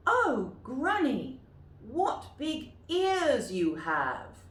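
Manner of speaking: put-on high, slow, loud girl's voice; wide-eyed girl, drawn out slowly. Shown as a demonstration. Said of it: "you might like to practice them".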